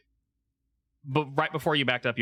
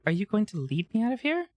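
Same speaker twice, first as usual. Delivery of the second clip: snake lady voice; 7 semitones higher than usual. Acted. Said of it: "looks back up at you and says".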